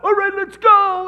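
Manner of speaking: very loud, high, full-throated roar; roaring rally cry. Retold or imitated. Captains sending the team onto the field, roaring. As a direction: bellow it to send the team running.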